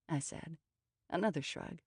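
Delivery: ordinary delivery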